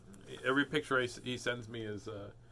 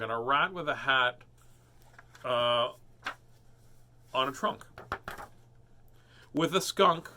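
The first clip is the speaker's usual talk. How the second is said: lively, sing-song storybook reading; animated picture-book read-aloud; reading to children; 3 semitones higher, 4 dB louder than usual. A narrator's reading.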